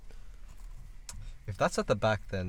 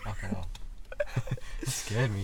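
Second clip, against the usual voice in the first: startled whisper; hushed, shaken swear; 8 semitones lower, 13 dB quieter than usual. Real, not acted. Startled by someone outside the car.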